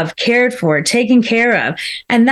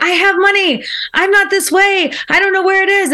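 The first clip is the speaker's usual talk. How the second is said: flustered; 7 semitones higher than usual. Retold or imitated. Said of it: "you're probably like".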